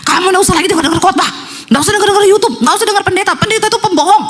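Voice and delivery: high-pitched angry tirade; acted possessed rant. Possessed husband forbids wife to hear preachers, high-pitched rant. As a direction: rail at her in a high, driven voice. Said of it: "matanya merah ngomong ke istrinya".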